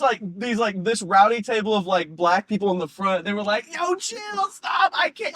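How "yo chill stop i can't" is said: laughing protest. Retold or imitated. Rowdy table crying with laughter.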